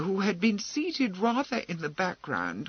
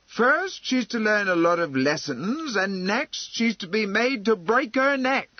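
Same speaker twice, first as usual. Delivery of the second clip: higher, loud indignant protest; outraged; 6 semitones higher, 5 dB louder than usual. Acted. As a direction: build in outrage to the last word. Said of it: "gasped Missus Flor".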